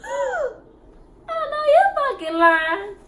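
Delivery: gasping, high and drawn-out disbelief; shocked disbelief. Retold or imitated. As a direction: gasp and stretch the words out in shock.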